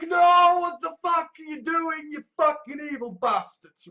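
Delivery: furious yell, pitched high and loud; hammed-up angry outburst at the villains; outraged shout. Imagined confronting evil people.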